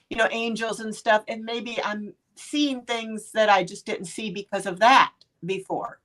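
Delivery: ordinary talk, nothing marked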